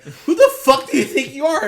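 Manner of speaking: an offended Italian yelling at Travolta, half laughing; mock angry yell. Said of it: "I would legit be like".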